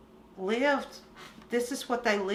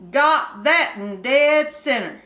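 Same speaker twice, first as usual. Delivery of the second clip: put-on higher, louder hillbilly boy complaining; bored country boy; 4 semitones higher, 5 dB louder than usual. Kim complaining to Winky about boredom, higher country boy voice. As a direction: whine it like a restless mountain kid. Kim's dialogue.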